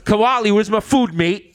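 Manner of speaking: high, hammed-up British roadman; terrible British accent. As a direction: demand your food in a bad British accent. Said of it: "terrible British accents".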